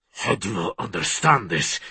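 low, rough-voiced question. A narrator's reading. Man caging his beast before her, voice rough. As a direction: rasp it low, barely restrained. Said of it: "Voice rough".

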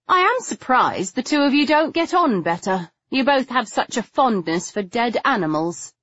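high, lilting mock surprise; lightly voiced dry tease; needling heroine. A narrator's reading. Heroine needles the visitor and Stoker, mock-surprised.

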